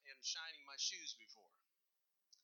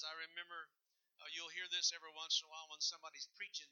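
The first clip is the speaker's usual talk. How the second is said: ordinary delivery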